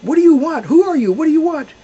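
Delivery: loud, high challenge to a stranger; yelling. A narrator's reading. Shepherds woken by bleating yell at the stranger, loud and high. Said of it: "the shepherds yelled out".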